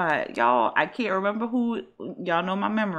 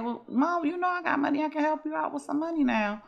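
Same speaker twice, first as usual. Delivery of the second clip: lightly voiced daughter, pitched higher; a daughter sweetly offering to help, higher; Sydney's voice; 9 semitones higher than usual. Daughter offers her mother money, in a lightly raised voice. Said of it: "And Sydney like".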